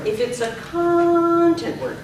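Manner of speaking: "content" drawn out long and high; slow phrase, lean hard on "content"; stress on content. Shown as a demonstration. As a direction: stretch the stressed word "content". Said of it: "but we stressed content".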